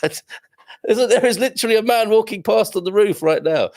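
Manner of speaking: high-pitched, giggly disbelief; laughing. Real, not acted. Streamer spots a man on the roof outside, laughing. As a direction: laugh through the words, voice jumping up.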